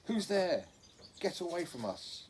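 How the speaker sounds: high, loud alarmed challenge from the dark; frightened call. A narrator's reading. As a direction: call out scared, warning the stranger off. Hidden girl calls out in dark.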